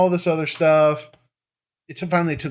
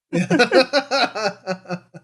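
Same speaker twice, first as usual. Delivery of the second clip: a burst of real laughter; laughing; 8 semitones higher than usual. Real, not acted. Laughing at hilarious remark.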